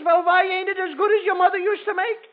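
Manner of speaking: high, huffy wife's voice; offended wife. Acted. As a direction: bristle like an insulted wife.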